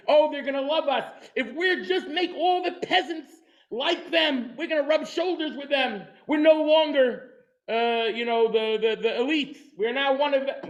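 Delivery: high, laughing mockery of naive optimism; a hammed-up, eager believer, sent up by the speaker; mock hopeful. Mocking the revolutionaries' hopes.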